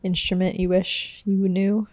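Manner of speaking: ordinary talk, nothing marked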